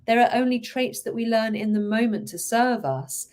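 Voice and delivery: normal talking voice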